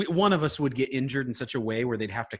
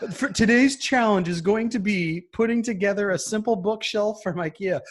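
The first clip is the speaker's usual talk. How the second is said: hammed-up host announcement, higher and louder with laughter; mock show host; 8 semitones higher, 8 dB louder than usual.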